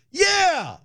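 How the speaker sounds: triumphant yell. Real, not acted. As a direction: let out a victorious whoop. Triumphant shout after the disclaimer.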